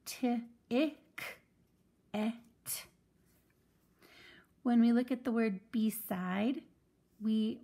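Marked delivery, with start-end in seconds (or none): 0.0-2.3 T, ick, et as separate sounds; teacher blending ticket sound by sound. Shown as a demonstration. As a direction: sound out each piece of ticket. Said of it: "read these vowels as short vowels".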